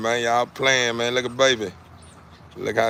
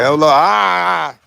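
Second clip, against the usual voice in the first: calling out; 6 semitones higher, 7 dB louder than usual. Real, not acted. Greeting the dogs in the yard.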